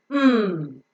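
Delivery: long, slow nasal "mm"; phonic M sound. Shown as a demonstration. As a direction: hold "mm" through the nose. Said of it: "It has got the phonic sound of".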